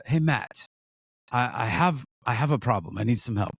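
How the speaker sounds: lightly attempted Israeli accent, imitating a friend; bad Israeli accent. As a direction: do a clumsy Israeli tour guide accent. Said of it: "That's the worst accent in the world".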